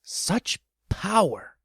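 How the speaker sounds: plain reading voice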